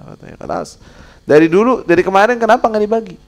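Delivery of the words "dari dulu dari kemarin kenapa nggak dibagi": high, loud, fast rebuke; scolding angel. Retold or imitated. Angel of death scolding the rich man, loud and fast. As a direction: scold him for waiting too long.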